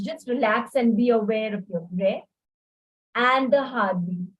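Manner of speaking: ordinary talk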